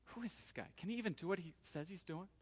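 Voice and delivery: hushed, skeptical aside from the crowd; very soft, doubting crowd voice; doubting murmur. Acted. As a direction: question it under your breath.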